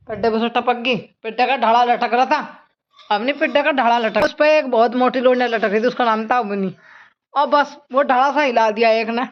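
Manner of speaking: high, sing-song nursery tale; a mother telling her sick daughter a sing-song story, high-pitched; storytelling to a child. Acted. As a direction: spin a playful tale for a little girl.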